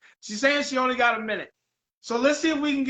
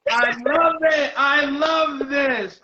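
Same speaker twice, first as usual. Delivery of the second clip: high, slow, drawn-out exclamation; real delight; 4 semitones higher, 5 dB louder, much slower than usual. Real, not acted. Host loving the surprise visit, delighted. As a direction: savour each word loudly.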